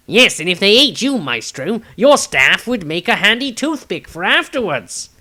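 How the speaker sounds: joking. A narrator's reading. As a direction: needle the wizard playfully. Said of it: "Tobias jokingly remarked".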